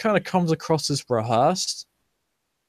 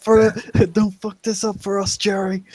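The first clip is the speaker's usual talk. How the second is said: higher, louder mob warning; crowd jeering; 8 semitones higher, 6 dB louder than usual. Acted. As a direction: snap it at Jerry as a crowd. Crowd warns Jerry.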